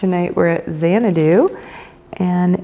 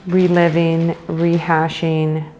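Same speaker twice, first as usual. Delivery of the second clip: guided meditation. Acted. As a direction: guide them into the breath, unhurried and measured.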